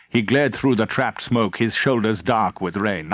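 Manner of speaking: usual speaking voice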